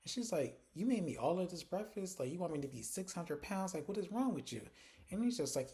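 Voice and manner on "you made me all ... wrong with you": higher-pitched mock indignation; retold attitude. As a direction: act out a touch of morning attitude. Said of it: "she actually has an attitude".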